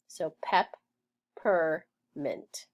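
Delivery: "pep, per, mint" with a pause after each syllable; teacher splitting "pep, per, mint" apart. Shown as a demonstration. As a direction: chop it into syllables. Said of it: "I would split it between".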